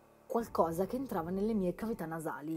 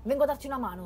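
loud, much higher call out; loud urgent reassurance; 7 semitones higher, 9 dB louder, much faster than usual. Acted. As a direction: shout to your fallen friend. Calling to fallen friend.

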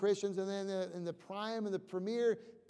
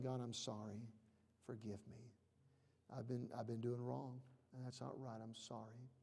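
hushed, lower, almost breathless confession; humble prayer; 7 semitones lower, 14 dB quieter than usual. Shown as a demonstration. Preacher showing the congregation how to confess, hushed. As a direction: pray it softly like a broken penitent.

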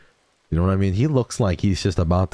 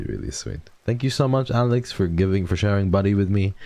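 ordinary talk

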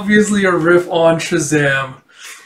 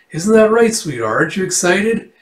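higher, coaxing cheer for show; put-on cheeriness; 5 semitones higher than usual. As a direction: prod sweetly for an enthusiastic answer. Grandpa falsely cheery to schoolgirl.

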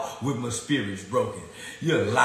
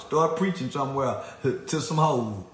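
ordinary delivery

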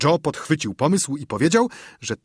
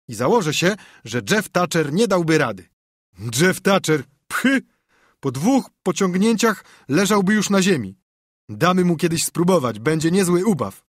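put-on higher, animated boy's bragging; cocky boy Tomek; 6 semitones higher, 4 dB louder than usual. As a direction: scoff excitedly at an absent rival. (przechwałki zadziornego chłopca, wyższym głosem)

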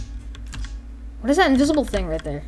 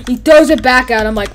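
ordinary talk, nothing marked

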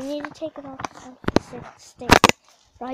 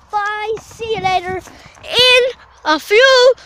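loud, slow, high-pitched farewell; exaggerated drawn-out shout; shouted sign-off; 7 semitones higher, 15 dB louder, slower than usual. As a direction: bellow the goodbye, stretching each word. Exaggerated sign-off before pausing.

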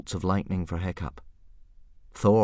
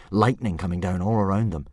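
ordinary delivery, nothing marked

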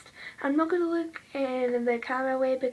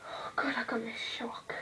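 startled whisper; 6 dB quieter than usual. Real, not acted. Startled while doing makeup.

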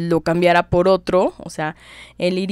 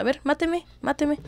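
defiant dare; 9 semitones higher than usual. Retold or imitated. Concha defying sword, arms open.

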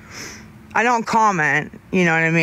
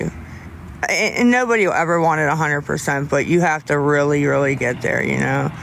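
normal talking voice

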